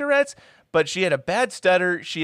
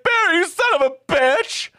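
high, loud outraged yell; hammed-up furious shout; mock angry yell; 14 semitones higher, 5 dB louder than usual. Heather catching Barry kissing another girl, loud mock yell.

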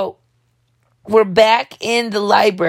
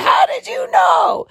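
high, drawn-out shout of amazement; astonished shout; 14 semitones higher, slower than usual. Retold or imitated. Dreamer amazed he knows about her, high astonished shout. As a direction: cry out in hammed-up disbelief.

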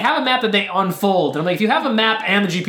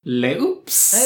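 hammed-up mock French accent; fake French accent. Podcaster mimics the fake Frenchman's slip, mock French accent. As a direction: put on a phony French accent. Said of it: "his Francesco accent is fake".

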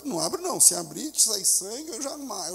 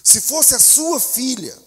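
loud, high, impassioned sermon voice; a pastor driving his point home, shouting with passion; full-force preaching; 8 semitones higher, 7 dB louder than usual. Acted.

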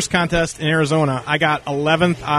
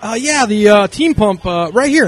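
sheepish; 6 semitones higher, 5 dB louder than usual. Retold or imitated. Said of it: "picks up a pump literally at Dave's knee".

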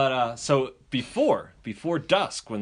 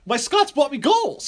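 high, loud gleeful kid impression; so excited; 14 semitones higher, 6 dB louder than usual. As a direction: brag like an overexcited kid, slowly and high. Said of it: "He's so excited".

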